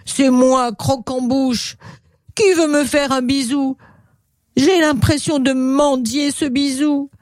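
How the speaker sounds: plaintive high-pitched crocodile; pleading storybook monster; Croque-en-Bouche. Acted. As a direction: beg pitifully for affection in a high creature voice. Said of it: "entendit la plainte du crocodile".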